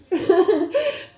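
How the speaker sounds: a loud, high burst of laughter; high delighted laugh. Real, not acted. Reader to viewer, laughing loudly before reading on.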